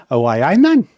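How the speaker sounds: broad Geordie greeting; Geordie accent. Shown as a demonstration. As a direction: try out a Geordie voice. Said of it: "That is the accent we're going for".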